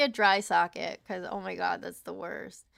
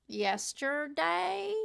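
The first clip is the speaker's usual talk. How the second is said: yesterday stretched very slowly; drawn out; 6 semitones higher, much slower than usual. Acted. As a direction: drag the word out. Word stretched, then repeated.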